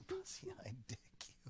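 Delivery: breathy giggling whisper; a hushed repeat between laughs; whispered echo. Real, not acted. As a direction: whisper it to yourself through laughter.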